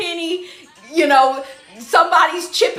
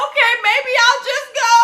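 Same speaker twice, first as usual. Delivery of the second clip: higher laughing imitation, half shouted; laughing character quote; 8 semitones higher than usual. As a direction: mimic him through laughter, pitched up. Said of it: "He said".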